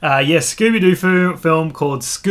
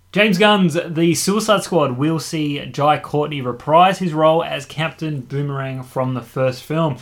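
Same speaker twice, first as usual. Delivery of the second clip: normal talking voice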